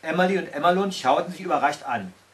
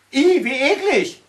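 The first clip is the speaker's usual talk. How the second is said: drawn-out high shriek of disgust; disgusted scream; 10 semitones higher, 8 dB louder, slower than usual. A narrator's reading. Two girls feeling the ground move, shrieking in disgust. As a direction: shriek it slowly, grossed out. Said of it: "schrien Pippelina und das Gänseblumenmädchen".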